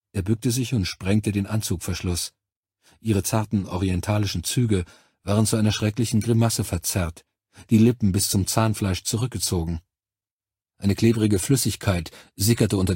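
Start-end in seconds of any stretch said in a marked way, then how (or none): none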